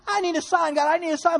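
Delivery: loud, high whining plea; hammed-up demand for a sign; mock begging. Churchgoer demanding a sign from God, mock begging. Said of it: "That's how we pray".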